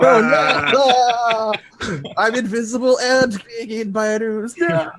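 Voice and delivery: high laughing dismay. Real, not acted. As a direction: laugh through the horror. Player's character eaten by ooze.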